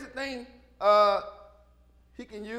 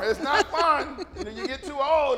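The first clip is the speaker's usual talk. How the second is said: usual speaking voice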